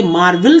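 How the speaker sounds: मार्वल read by spelling as "maar-vil"; wrong reading of मार्वल. Shown as a demonstration. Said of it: "तो नहीं पढ़ते हैं".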